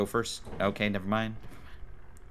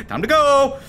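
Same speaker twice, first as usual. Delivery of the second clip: rallying call; urgent call; 5 semitones higher than usual. Real, not acted. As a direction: call it out high, stretching the last word. Calls the others to leave.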